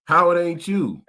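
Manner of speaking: ordinary talk, nothing marked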